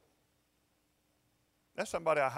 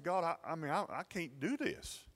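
retold fearful prayer; 7 semitones higher than usual. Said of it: "I was so afraid".

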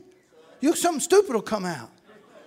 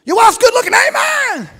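hammed-up shout of Amen, much higher and louder; mock shouting amen; 12 semitones higher, 12 dB louder than usual. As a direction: yell it like a worked-up churchgoer. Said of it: "so busy yelling amen".

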